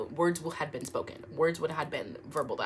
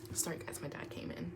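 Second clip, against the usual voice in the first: hushed whisper, much softer; whispered apology; 13 dB quieter than usual. Real, not acted. Telling viewers dad walked in, whispered. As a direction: whisper so dad won't hear.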